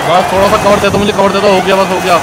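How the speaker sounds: cover me shout. Real, not acted. Pleading for cover in final seconds.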